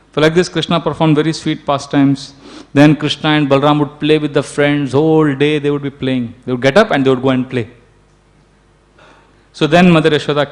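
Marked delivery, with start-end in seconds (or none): none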